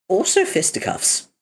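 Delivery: ordinary delivery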